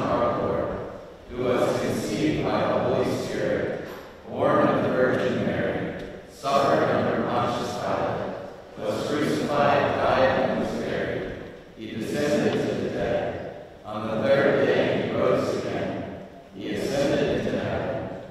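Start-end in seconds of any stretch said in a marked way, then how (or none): none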